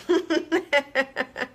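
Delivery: a short amused chuckle; laughing after calling viewers beings of light. Real, not acted. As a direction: laugh lightly at your own phrase.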